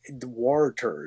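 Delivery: slow "water" with a strong r, wor-ter; imitating R-heavy water. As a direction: drag out the r in "water". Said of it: "You're putting the R".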